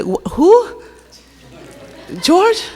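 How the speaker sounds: high, puzzled rising question; imitating a puzzled friend; confused. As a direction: mimic a baffled friend, pitch way up. Christy confused by the accent.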